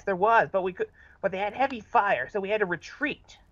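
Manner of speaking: ordinary talk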